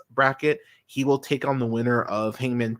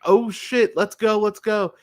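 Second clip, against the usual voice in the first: higher, louder retold fan excitement; excited reaction; 10 semitones higher, 4 dB louder than usual. Wrestling fan retelling his reaction to the sharpshooter, excited. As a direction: re-enact getting hyped as the hold goes on.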